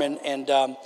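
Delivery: ordinary delivery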